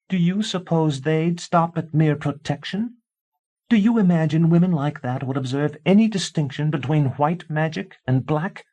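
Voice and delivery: wife darkly warning husband; ominous wife. A narrator's reading. Tansy voiced.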